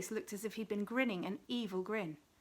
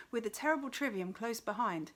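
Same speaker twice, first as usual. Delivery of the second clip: ordinary delivery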